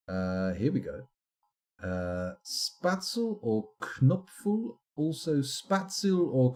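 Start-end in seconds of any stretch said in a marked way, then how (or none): none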